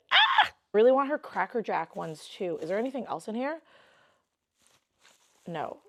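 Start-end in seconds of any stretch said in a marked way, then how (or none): 0.1-0.2 short, sudden squeal of excitement; brief burst of giddy screaming; excited scream. Real, not acted. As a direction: let out a quick delighted shriek. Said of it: "I'm so excited".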